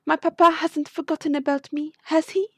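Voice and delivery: lightly voiced young lady. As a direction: play the anxious daughter lightly. Voices Cataline, the young noble client.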